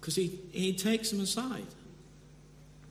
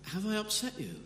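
gentle, innocent, slightly higher question; 6 semitones higher than usual. Retold or imitated. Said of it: "very innocently".

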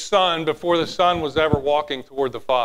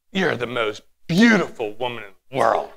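lower, flat-toned compliment that rings false; wrong tone on a compliment; 8 semitones lower, 3 dB quieter than usual. Shown as a demonstration. Pastor shows the congregation how tone ruins words, mock wrong tone. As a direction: say something sweet in a way that spoils it. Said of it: "It's not what you say".

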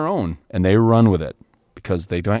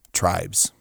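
usual speaking voice

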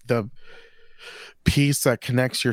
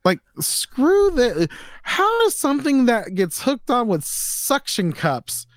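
high, slow disbelief; can't believe this exists; incredulous; 10 semitones higher than usual. Real, not acted. Incredulous rant about product.

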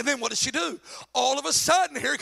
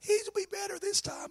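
mock hopeful woman; 8 semitones higher, 6 dB quieter than usual.

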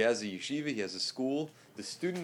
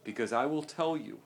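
ordinary talk, nothing marked

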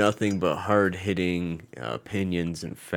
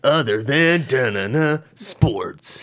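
high, loud, slow title with sung fill; jingle announcer; 6 semitones higher, 5 dB louder, much slower than usual. Shown as a demonstration. Host offering an intro take, loud slow announcer jingle.

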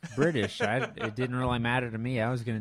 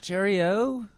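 loud, higher-pitched fake British cheerio; bad British accent; 8 semitones higher, 5 dB louder than usual. Shown as a demonstration. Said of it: "really bad English accent".

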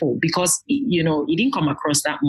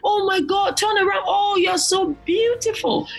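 put-on much higher, shouted girlish delight; excited girls; 14 semitones higher than usual. Daughters admiring each other's dresses, high excited shouting. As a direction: squeal it like an excited little sister. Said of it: "they are so excited".

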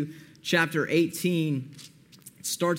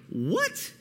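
high-pitched, softer disbelieving question; incredulous; 12 semitones higher, 6 dB quieter than usual. Acted. Preacher reacting to the unforgiving servant, high incredulous yelp. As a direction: squeak it upward in mock disbelief.

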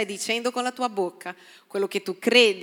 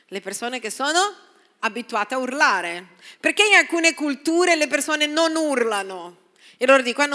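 ordinary talk, nothing marked

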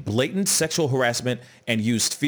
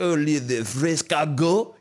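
mock Spanish accent; 4 semitones higher than usual. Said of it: "it's like".

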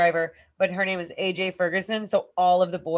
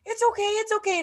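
put-on much higher little boy voice soothing; kid brother comforting; 14 semitones higher than usual. Nanny voicing the older brother comforting the hurt toddler. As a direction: play the sweet five-year-old calming his brother. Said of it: "He's like".

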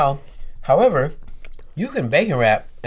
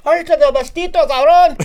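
much higher, louder put-on Spanish shout; mock Mexican Spanish; 6 dB louder than usual. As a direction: impersonate Mexican arguing. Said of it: "I would do an impression".

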